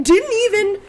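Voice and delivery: usual speaking voice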